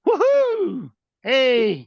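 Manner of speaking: high, loud delighted whoop; excited cheer. Real, not acted. Greeting a caller from Africa.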